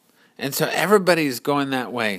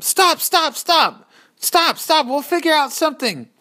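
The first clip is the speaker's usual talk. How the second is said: high, loud pleading shout; urgent calling out; 14 semitones higher, 4 dB louder than usual. Acted. Courage shouts to halt the fleeing people. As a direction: call out to stop the crowd. Courage tries to stop crowd.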